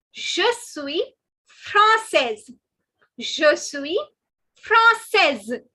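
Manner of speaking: française ending in a voiced 'sez'; française as 'frahn-SEZ', slow and emphatic; feminine, sounded ending. Shown as a demonstration. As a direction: sound the final z of française, punched clearly. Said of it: "Did you notice the difference".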